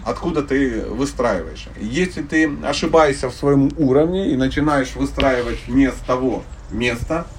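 ordinary talk

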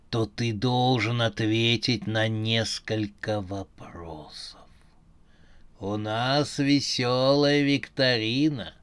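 low, slow robotic voice; slow, low, machine-like quiz host; metallic voice. Acted. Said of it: "металлический голос".

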